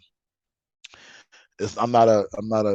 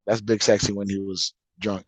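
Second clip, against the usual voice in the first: ordinary talk, nothing marked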